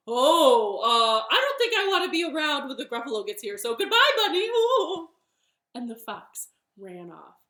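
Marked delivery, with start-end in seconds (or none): 0.1-5.1 very loud, rushed, rising high; panicked fox. Acted. Fox flees from the Gruffalo, panicked and loud. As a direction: gabble a hammed-up getaway.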